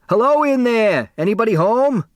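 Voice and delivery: loud high hail from down the hall; hollering a hello through the door; calling out. Acted. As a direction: call out to the whole room. Character calling out from corridor.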